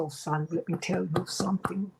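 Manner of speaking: lightly imitating an old German man's accent. Retelling a Holocaust survivor's words to her brother, light German accent. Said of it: "in his thick German accent".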